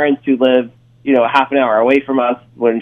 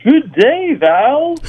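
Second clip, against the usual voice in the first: high, lilting put-on Australian; fake Australian accent; 11 semitones higher, much slower than usual. Caller greeting the girlfriend he fooled, fake Australian accent. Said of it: "you don't actually have a, an Australian accent".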